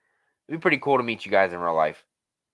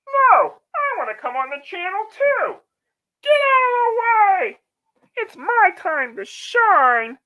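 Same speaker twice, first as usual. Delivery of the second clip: much higher, far-off voice, bratty and pushy; bratty intruder character; 4 dB louder, slower than usual. Acted. Intruder character grabbing the stream from Matt, bratty. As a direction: shove your way onto the show like a brat. Character pushing onto channel.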